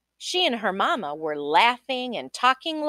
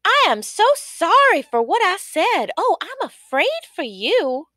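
higher, louder anguished apology; sorry mama; 10 semitones higher, 6 dB louder than usual. Acted. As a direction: plead with her daughter in worry. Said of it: "cried the mama".

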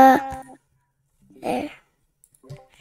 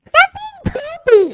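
squeaky high-pitched taunt, much higher; silly high voice; 11 semitones higher, 4 dB quieter than usual. Pretend. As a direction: tease in a cartoonish high squeak. Kid teasing in silly voice.

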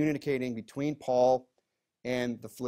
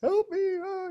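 much higher, whiny mock plea; blubbering baby; 3 dB louder, slower than usual. Pastor mocking himself when sick, high whiny baby plea. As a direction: whimper it like a big baby. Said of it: "turned into a big blubbering baby".

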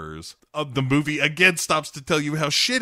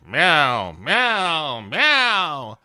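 loud imitated cat meows, climbing higher; drawn-out mock mewing; Grumpy Cat; 4 semitones higher, 4 dB louder, much slower than usual. Cat answering Crystal only in meows, mock pitiful. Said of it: "Grumpy Cat is just like".

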